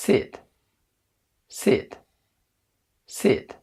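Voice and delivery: "sit" with short ih close to Arabic kasra; crisp model of "sit" with i, not e. Shown as a demonstration. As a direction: smile slightly while saying "sit". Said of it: "مكسورة قريبة جدًا من العربي".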